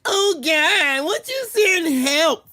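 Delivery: high, slow mocking whimper; hammed-up sobbing plea for help; mock crying. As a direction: whine it like a crybaby begging God. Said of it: "on the internet crying".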